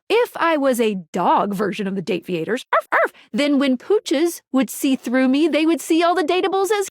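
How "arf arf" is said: imitated "arf, arf"; playful dog bark. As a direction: yap it like a cartoon dog.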